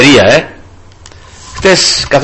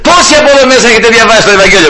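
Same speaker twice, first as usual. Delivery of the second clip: calling out; 7 semitones higher, faster than usual. Retold or imitated. Addressing everyone on the bus, calling out. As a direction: rattle the question out fast and high. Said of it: "Λέω".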